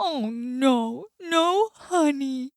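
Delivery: slow dismayed bear voice, stretching no. Acted. Bear at his empty cupboard, dismayed and slow. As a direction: mourn the missing honey.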